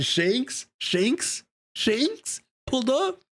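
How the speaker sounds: incredulous fan hype, climbing in pitch; hyped repetition. Real, not acted. Hyped fan repeating the name.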